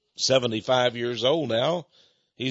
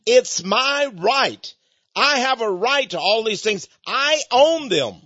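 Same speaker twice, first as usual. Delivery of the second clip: "It's my right" slow and higher, each word leaned on; emphatic repeat; 7 semitones higher, slower than usual. Shown as a demonstration. Preacher repeating the devil's claim to the congregation, slow and emphatic. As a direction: drive home "It's my right", slowly and pitched up. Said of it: "did you understand what I just said?".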